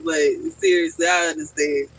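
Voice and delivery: ordinary delivery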